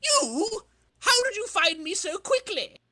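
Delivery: hammed-up shock at being caught; villain caught off guard; startled villain. Villain surprised by Spider-Man.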